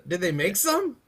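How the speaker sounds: ordinary talk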